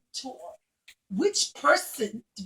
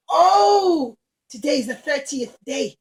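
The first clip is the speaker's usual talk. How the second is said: grand rich lady; 8 dB louder than usual. Acted. Rich Roman lady welcoming Rabbi Akiva, loud and gracious. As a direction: greet him like a generous grand lady.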